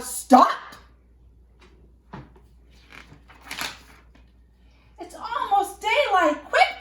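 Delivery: loud, high, urgent yell; urgent monster shout. Acted. A monster yells to the dancers to hurry home, loud. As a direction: sound the alarm before dawn.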